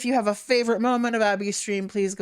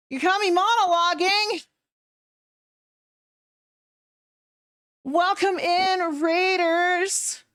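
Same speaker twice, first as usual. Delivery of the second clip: high, bright streamer greeting voice; cute anime streamer; 11 semitones higher, 3 dB louder, slower than usual. Acted. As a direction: squeal hello to the raiders. Greeting raiders, switches to stream voice.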